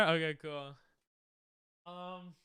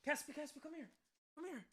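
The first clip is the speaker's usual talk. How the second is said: soft high coaxing call to a pet; calling the dog; 10 semitones higher, 13 dB quieter than usual. Real, not acted. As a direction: coax the dog over gently.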